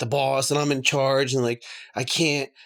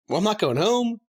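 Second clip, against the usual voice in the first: lightly voiced, higher-pitched wry remark to himself; wry resigned quip; 7 semitones higher than usual. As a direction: toss it off like a dark joke to yourself. Retold own reaction in mirror.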